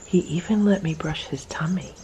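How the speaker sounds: breathy whisper so the cat stays calm; hushed whisper about the tummy. Real, not acted. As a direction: whisper it over the purring cat.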